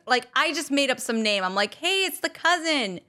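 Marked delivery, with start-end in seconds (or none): 1.7-3.0 higher put-on caller voice; fake cousin voice. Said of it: "pretend to be someone different".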